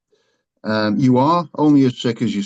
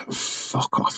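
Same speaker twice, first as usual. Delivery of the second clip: mild mock dismissal; 7 dB quieter than usual. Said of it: "And he said".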